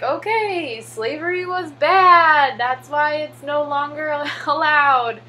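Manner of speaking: teacher giving up and telling the class the answer; exasperated teacher. Retold or imitated. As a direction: spell out the obvious in mock exasperation.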